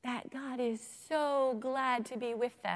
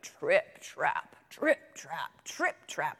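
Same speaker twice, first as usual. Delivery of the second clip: low, heavy "trip, trap"; heavy goat hooves; 4 semitones lower, 3 dB louder, much slower than usual. A narrator's reading. Biggest goat crossing the bridge, low heavy hoofbeats. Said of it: "the biggest billy goat was so heavy".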